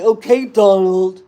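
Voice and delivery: loud mocking Okay, Donald; hammed-up taunt at Donald; Rosie mocking Trump. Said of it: "imitate Donald Trump like that".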